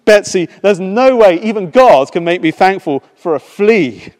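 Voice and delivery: high, loud exasperated protest. Acted. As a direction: refuse it with exasperation. Corrie protesting.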